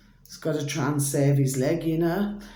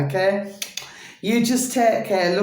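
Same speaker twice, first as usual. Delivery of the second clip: ordinary talk, nothing marked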